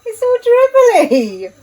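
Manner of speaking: giggling through the words; laughing. Real, not acted. Pancake batter goes wrong.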